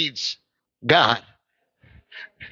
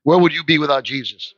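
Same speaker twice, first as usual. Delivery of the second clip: ordinary talk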